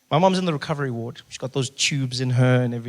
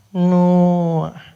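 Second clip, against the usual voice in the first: clerk voice; 3 semitones higher, 7 dB louder than usual. Retold or imitated. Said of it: "clerk repeats in her voice".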